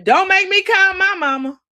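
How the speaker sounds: high, loud mock scream of a grown woman; retold scream. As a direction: yell it like a threat you'd never follow through on. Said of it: "Drew is screaming".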